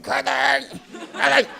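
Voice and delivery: high, pained plea as a hand gets crushed; pained cry. Retold or imitated. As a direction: cry out her name as your hand is squeezed. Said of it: "I'm like".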